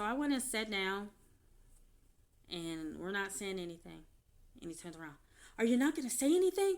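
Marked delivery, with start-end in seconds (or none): 5.6-6.8 quoting ex-husband. Retold or imitated. Husband's demanding quote.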